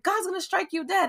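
high, hammed-up warning of doom; dramatic dad. Dad warns grieving daughter, high melodramatic voice. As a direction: play the melodramatic father. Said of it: "It was like a novela, yo".

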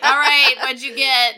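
loud laughing call to the group. Pretend. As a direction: shout it through laughter. Players dumping purse, laughing.

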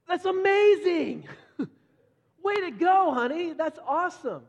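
high, loud gushing praise; dad thrilled at his daughter's drawing; excited praise. Retold or imitated. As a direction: cheer her progress like a thrilled dad.

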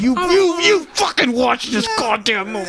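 high, mock yelling parent; angry parent. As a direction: bark it like a furious parent. Mock angry parent forcing kid.

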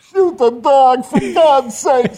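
very high, drawn-out hysterical scream; background yelling. Retold or imitated. Prankster posing as the bitten victim, hysterical high yell. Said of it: "in the background yelling".

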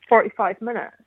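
normal talking voice

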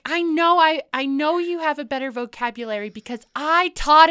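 high, loud mock mom; mom's voice. Daughter imitating mom about vocabulary, high and loud. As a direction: play mom insisting firmly.

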